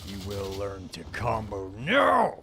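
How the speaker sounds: loud, slow rising bark; raging gamer boss. Pretend. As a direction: roar the order like a tyrant boss. Boss barking orders at staff.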